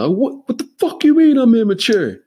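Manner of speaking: high, loud outraged yell; indignant yell. Retold or imitated. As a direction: bellow it across the room, offended. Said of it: "immediately I just yell".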